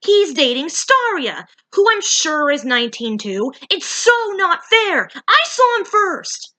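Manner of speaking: high, loud whiny complaint; jealous outburst. Acted.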